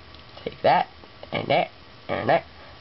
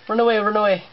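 terrified gibbering; 7 semitones higher, 3 dB louder, faster than usual. Pretend. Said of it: "I'm scared of him".

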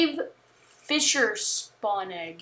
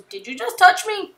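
high, louder mock-offended cat; indignant cat; 9 semitones higher, 5 dB louder than usual. Retelling the cat's line to the dog, high mock indignation. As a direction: quote the cat in a raised, affronted pitch. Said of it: "a dog touches a cat and he goes".

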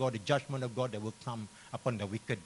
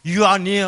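loud, high "You are near"; emphatic proclamation; 7 semitones higher, 14 dB louder than usual. Acted. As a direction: lean hard on "near" in a raised voice. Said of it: "Look at the word near".

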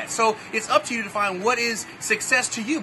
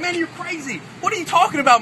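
higher pitch, scoffing disbelief; incredulous kid; 7 semitones higher than usual. Retold or imitated. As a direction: tell him he's out of his mind. Kid arguing with the guru.

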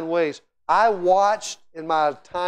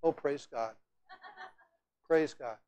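softer, limp, polite praise God; mock subdued praise; 3 semitones lower, 11 dB quieter than usual. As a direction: mimic a half-hearted churchgoer. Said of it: "You think they were going".

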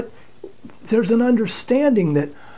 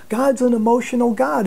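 normal talking voice

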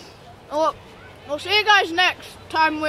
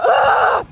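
pained shout; 7 semitones higher, 6 dB louder than usual. Real, not acted. Needs to spit out candy.

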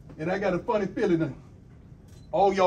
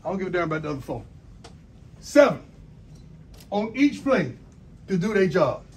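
rising, louder call to the team; pep speech; 4 semitones higher, 7 dB louder than usual. Real, not acted. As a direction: fire the players up, building. Coach fires up team.

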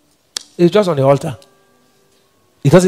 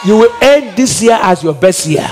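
full-force preaching. Acted. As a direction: proclaim each blessing like a decree.

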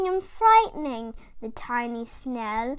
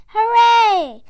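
much higher and louder cheer; cheering shout; 9 semitones higher, 14 dB louder than usual. Acted. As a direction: villagers cheer the whale away. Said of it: "the villagers shouting".

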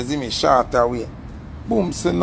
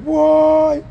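very high, loud shriek of murder; retold girl's scream, pitched way up; 13 semitones higher, 4 dB louder, much slower than usual. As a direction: imitate the girl bawling out from the back seat. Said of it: "Me hear the girl a bawl out".

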